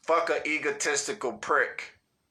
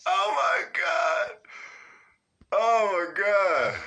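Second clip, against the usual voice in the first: belly laughing; 2 semitones higher, slower than usual. Real, not acted. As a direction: laugh hard, barely speak. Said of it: "I'm belly laughing".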